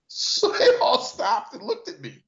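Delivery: words break into a high laugh mid-sentence. Real, not acted. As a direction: laugh through it as the memory hits. Laughing while retelling the scene.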